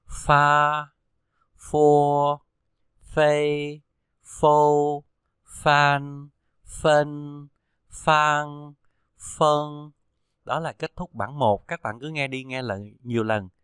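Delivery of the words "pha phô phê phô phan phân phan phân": pha, phô read loud and clear, each one doubled; f syllables drill. Shown as a demonstration. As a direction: model the f sound like Vietnamese ph. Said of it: "Chúng ta cùng đọc lại nào". (đọc mẫu pha phô rõ, to, mỗi âm hai lần)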